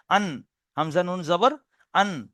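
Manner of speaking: slow আন with the tongue tip on the palate; slow letter demo. Shown as a demonstration. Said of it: "জিভের আগাটা উপরের তালুতে লেগে যাচ্ছে". (ধীরে আন, জিভের আগা তালুতে লাগিয়ে)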